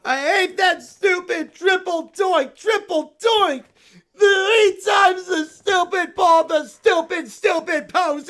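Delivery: whiny tantrum. Acted. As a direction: stomp through the complaints like a sore loser. Bear toy rages over football loss.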